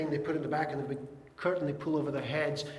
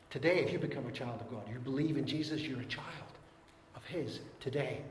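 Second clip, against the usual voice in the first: ordinary delivery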